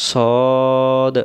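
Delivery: "সদ" with one long rounded "o"; rounded-mouth pronunciation. Shown as a demonstration. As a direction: round the mouth and hold "সদ" as a drawn-out "sawd". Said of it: "মুখটা গোল হবে". ("সদ" মুখ গোল করে লম্বা টেনে)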